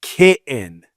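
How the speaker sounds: glottal stop mid-kitten; dropped T in kitten. Shown as a demonstration. Teasing her missing T's, kitten with a gap. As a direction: swallow the TT in kitten. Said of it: "she doesn't pronounce it".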